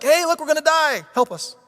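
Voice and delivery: high, loud hammed-up cry for help; mock panic. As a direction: yelp for help like a frightened disciple. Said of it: "And the first one, like".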